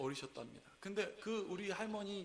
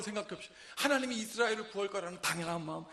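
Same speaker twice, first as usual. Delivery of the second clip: normal talking voice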